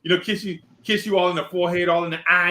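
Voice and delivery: normal talking voice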